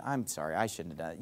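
low, soft admission of fault; conciliatory apology. Acted. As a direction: give in quietly, dropping pitch and volume. Models apologizing to end fights.

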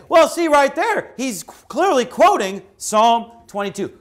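mock triumph of a doubter, pitched high; mocking the critics. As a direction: sneer it like a know-it-all opponent. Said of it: "And you say".

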